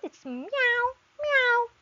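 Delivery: high, slow cat meowing. Pretend. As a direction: mew like a kitten for a child. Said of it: "What's the sound?".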